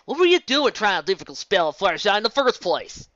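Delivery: loud scolding question. A narrator's reading. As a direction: tell her off. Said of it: "Applejack scolded her".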